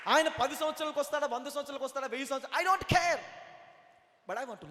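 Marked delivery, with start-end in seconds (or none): 0.1-3.2 loud, high pulpit declaration; fervent preacher, punching the closing words loudly; full-force preaching. Real, not acted. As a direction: hurl it out to the congregation.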